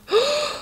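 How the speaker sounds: high gasping intake; Gruffalo's child gasps at the huge shadow; sharp gasp of fright. Acted. Shadow falls, character gasps.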